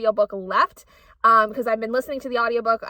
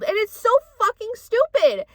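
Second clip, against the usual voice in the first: outraged rant; 8 semitones higher than usual. Real, not acted. Ranting about abusive mother.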